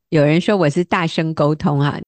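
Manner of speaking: normal talking voice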